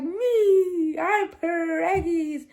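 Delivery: high, loud, drawn-out reveal; excited announcement. Retold or imitated. Daughter re-enacting the pregnancy news to her mom, excited. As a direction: re-enact a big gleeful reveal, stretching the words.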